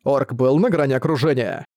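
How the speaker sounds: plain reading voice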